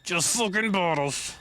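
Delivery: high, drawn-out put-on voice; mocking repeat. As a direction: stretch it out high in a silly voice.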